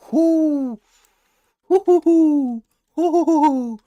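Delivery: high, loud whoops of joy; excited hooting. Real, not acted. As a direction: whoop it up like a hype man. Excited hooting over trade.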